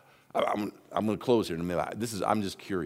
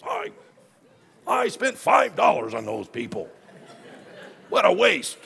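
indignant grumble; 14 semitones higher, 7 dB louder, slower than usual. Retold or imitated. Grumbling to himself about the scam.

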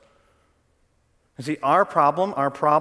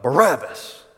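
a woman's retold yell of the name, louder; mob yell; 5 dB louder, slower than usual. Woman in the crowd calling for Barabbas, mock yell. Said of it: "she yells out".

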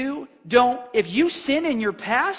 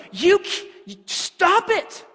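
full-on rebuke; 5 semitones higher than usual. Retold or imitated. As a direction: snap at her to stop it. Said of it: "she just full on rebuked me".

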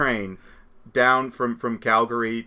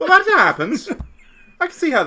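laughing; 8 dB louder than usual. Real, not acted. Laughing at the lost ball story.

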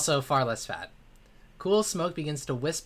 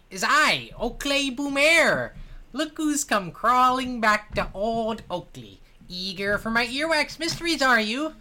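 high, slow, gloating dwarf voice; smug dwarf; 10 semitones higher, 6 dB louder, slower than usual. Acted. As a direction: crow at them like a smug dwarf.

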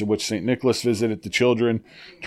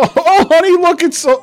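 high, loud gushing parent; a delighted parent squealing at the kid; mock doting parent; 6 dB louder, faster than usual. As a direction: gush like a delighted mom. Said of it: "I should do it in an accent".

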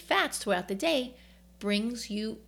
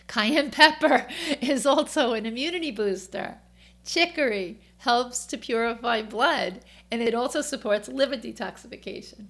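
New York accent; 3 semitones higher, 7 dB louder than usual. Real, not acted. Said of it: "My New York accent comes out a lot".

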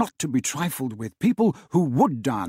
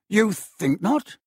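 with a struggle; 6 semitones higher, slower than usual. A narrator's reading. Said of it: "he said with a struggle".

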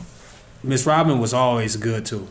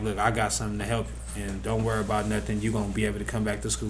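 reassuring, quiet; 4 semitones lower, 8 dB quieter, faster than usual. Retold or imitated. Quoting Miss Robin's reassurance.

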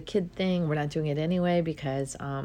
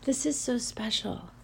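tearful; 5 semitones higher than usual. Real, not acted. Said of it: "tearing up a little smidge".

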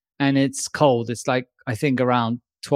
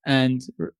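usual speaking voice

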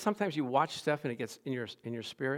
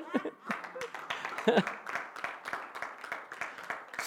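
laugh; 9 dB quieter than usual. Real, not acted. Laugh after praise.

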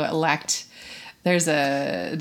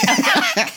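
high burst of laughter; 6 dB louder than usual. Real, not acted. Laughing at own mistake.

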